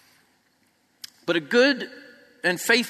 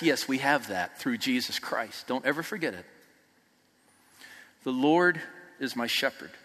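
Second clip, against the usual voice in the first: much softer and lower, intimate; hushed reverence; 8 semitones lower, 8 dB quieter than usual. Real, not acted. Preaching to the congregation about Christ, hushed reverence. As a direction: drop to a near hush on his name.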